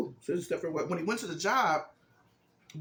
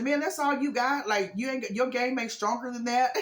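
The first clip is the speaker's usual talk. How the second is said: higher-pitched mock disbelief; incredulous ribbing in a raised pitch; teasing dad; 6 semitones higher than usual. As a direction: needle him, voice pitched up. Dad teases Eric's weak game.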